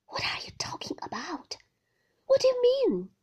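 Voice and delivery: high, loud bewildered question; startled cry. Acted. Husband stunned by his wife's accusation, crying out. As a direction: blurt it out in shock. Maxim startled by her words.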